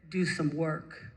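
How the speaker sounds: low-pitched, lightly voiced inner command; measured low divine voice; voice from deep within. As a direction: give a solemn order from deep down. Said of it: "this voice coming from deep down within".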